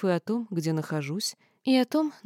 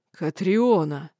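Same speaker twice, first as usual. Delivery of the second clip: growled, louder name; angry Dinar growls her name; 6 dB louder, much slower than usual. Acted. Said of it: "прорычал медноволосый".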